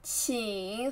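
请 drawn out, low and dipping; third tone. Shown as a demonstration. Teacher asking learners to read after her, dipping tone on 请. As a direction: sink low on 请, then let it rise. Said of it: "third tone".

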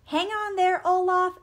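high, loud urgent warning; exclaimed. A narrator's reading. Elsa catches Olaf at the cliff, exclaiming. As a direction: cry out to stop a fall. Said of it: "exclaimed Elsa".

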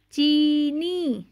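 चीनी with the ई drawn out slowly; long ee in cheeni. Shown as a demonstration. Teacher reading aloud to class, stretching चीनी's ee. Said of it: "आओ ज़ोर ज़ोर से पढ़ें".

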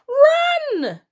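very high, loud cry; desperate shout. A narrator's reading. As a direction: yell it in desperation. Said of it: "shouted Hamish".

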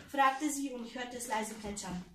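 usual speaking voice

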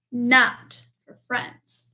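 slow, weighted not for friends; slow emphasis. Acted. Bear telling Mouse the sign is not for friends, slow emphasis. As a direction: lean on each word slowly.

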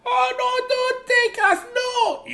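high squeaky pleading voices; squeaky tiny voices. Acted. Tiny creatures pleading in book.

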